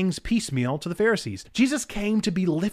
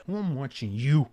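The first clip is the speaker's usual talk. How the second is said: normal talking voice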